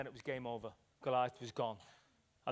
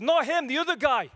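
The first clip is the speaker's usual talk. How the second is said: mock shout; 10 semitones higher, 8 dB louder than usual. Retold bar fight, acted quote.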